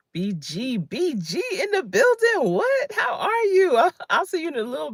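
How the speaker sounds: high, delighted squeal of welcome; light up at seeing a friend; excited greeting. Real, not acted. Host greets a regular viewer arriving in chat, high and delighted.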